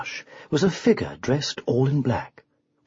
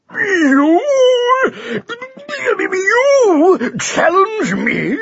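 very slow, loud, much higher outrage; spluttering rage; 4 dB louder, much slower than usual. Acted. The enraged champion splutters at the challenge. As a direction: splutter with disbelief and fury. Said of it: "he spluttered".

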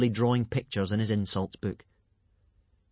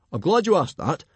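loud, hearty and high; enthusiastic; 7 semitones higher, 6 dB louder than usual. A narrator's reading. Chief answers Hiccup's question, hearty and loud. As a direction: answer with big gusto. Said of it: "replied Stoic the Vast enthusiastically".

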